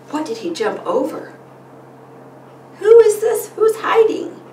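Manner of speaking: animated storytime voice with gasps and suspense; reading to children. Shown as a demonstration. Parent reading a frog book to a child, animated.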